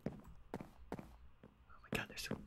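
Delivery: whispered under the breath, exasperated. Real, not acted. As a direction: whisper it as a frustrated aside. Muttering aside while chasing mob.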